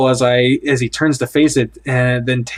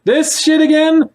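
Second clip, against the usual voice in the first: exasperated disbelief; 5 dB louder than usual. Acted. Exasperated high drawn-out.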